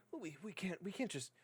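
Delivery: lightly voiced worried traveler, faltering; traveling man. Traveler reluctant to leave others behind, hesitant protest. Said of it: "another man, uh, in, like, fairly".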